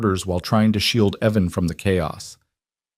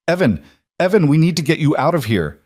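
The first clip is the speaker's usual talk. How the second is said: urgent call; 7 semitones higher than usual. Acted. Patricia urgently pulling him out.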